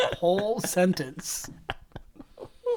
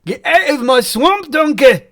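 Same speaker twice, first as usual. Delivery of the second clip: higher, louder Scottish ogre voice; Scottish accent; 7 semitones higher, 7 dB louder than usual. Shown as a demonstration. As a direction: bellow it like an angry Scottish ogre. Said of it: "It's the out".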